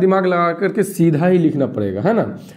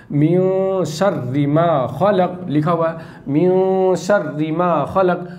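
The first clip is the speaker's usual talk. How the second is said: Quran recitation; slower than usual. Shown as a demonstration. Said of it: "ये देखिए".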